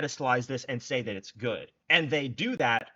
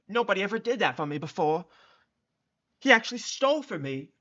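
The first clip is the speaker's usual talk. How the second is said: high, loud mocking impression of the woman; mocking imitation; 7 semitones higher, 3 dB louder than usual. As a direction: mimic her dreamy gushing to mock it. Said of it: "She actually said that".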